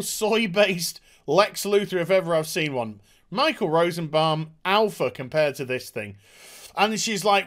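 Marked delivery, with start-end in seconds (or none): none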